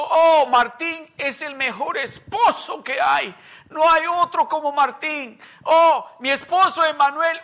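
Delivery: loud, high, laughing gush; gushing about a spouse. Retold or imitated. Believer gushing to everyone about her husband, loud and laughing. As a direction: brag about your husband like a giddy newlywed. Bragging newlywed voiced.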